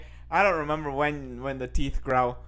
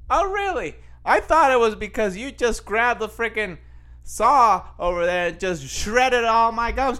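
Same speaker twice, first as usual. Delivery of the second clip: sarcastic retort; 5 semitones higher than usual. Retold or imitated. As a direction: fire back with mock disbelief. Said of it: "I'm like".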